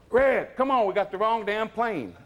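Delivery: high, loud call to Red; yelling across to his friend in alarm; retold call. [name] calling [name] back from the plane, loud and high.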